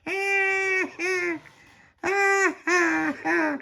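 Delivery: very high squealed cackle; baby's laugh. A narrator's reading. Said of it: "Stop laughing, baby Lucia".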